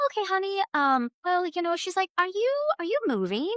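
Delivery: playing the gentle reader who calls her honey; a lightly voiced sweet medium; quoting the psychic. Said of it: "she goes".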